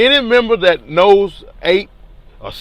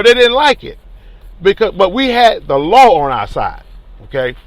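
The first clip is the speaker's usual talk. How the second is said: ordinary talk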